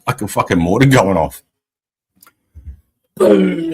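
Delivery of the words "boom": high, loud mock explosion noise; imitated bang of a metal bin; imitated boom. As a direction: blast it out like a mortar going off. Bin struck like a mortar.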